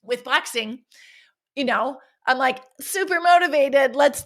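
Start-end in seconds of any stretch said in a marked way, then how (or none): 2.8-4.2 higher, louder hyped-up self; mock super-pumped. Coach recalling her overhyped self, mock excited. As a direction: replay her own overeager gym hype.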